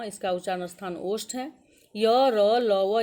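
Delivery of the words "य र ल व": much louder, emphatic letter chant; teacher loudly reciting a consonant group; loud letter recital. Shown as a demonstration. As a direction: call each letter out to the whole class. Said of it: "ये अंतस्थ व्यंजन है".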